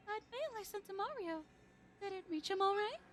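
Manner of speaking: Princess Peach voice. Acted. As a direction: sigh it out like a longing princess. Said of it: "my Princess Peach voice".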